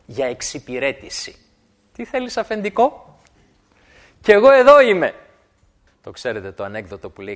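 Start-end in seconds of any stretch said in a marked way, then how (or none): 1.9-5.1 eager servant offering to help, louder at the end; fawning servant. Acted. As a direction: play the eager servant who means trouble. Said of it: "ως δούλος". (δουλοπρεπής προθυμία)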